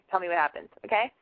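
usual speaking voice

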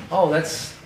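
imitating dad's offhand shrug of a remark; nonchalant. Retelling his father's casual reaction to a huge turkey, nonchalant. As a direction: toss it off like it's nothing special. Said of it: "Like, so nonchalant".

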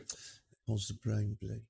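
quiet mocking British accent; fake British whisper. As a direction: breathe it out like a fragile aristocrat. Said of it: "I have to have a fake British accent".

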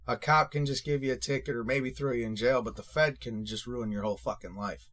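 ordinary delivery

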